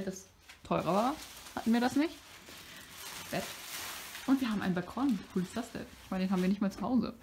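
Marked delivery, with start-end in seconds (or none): none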